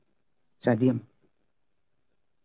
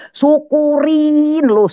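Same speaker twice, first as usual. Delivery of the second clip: high, loud, long-drawn taunt; retold gloating; 5 dB louder, much slower than usual. Wife to electrocuted husband, loud gloating. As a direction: crow it, stretching the first word out.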